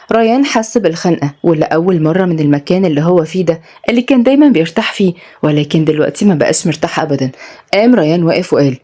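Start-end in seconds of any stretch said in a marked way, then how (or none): none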